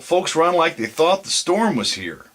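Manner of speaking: loud, high excited yell. Acted. Will yells to Jim about people fleeing the storm, loud and high. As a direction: shout it over the storm wind. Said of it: "yelled Will".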